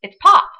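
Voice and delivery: It's pop, pop hit high and loud; insisting on pop. Real, not acted. Telling viewers the Midwest word is pop, high and loud insistence. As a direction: state it like it's obvious, it's pop. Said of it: "I grew up calling it pop".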